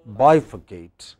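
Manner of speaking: "bifurcate" slowly, BI stressed. Shown as a demonstration. Teacher applying the A T E rule, stress on BI. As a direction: stretch and stress the first syllable of "bifurcate". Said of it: "two syllables before A T E".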